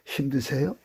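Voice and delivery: ordinary talk, nothing marked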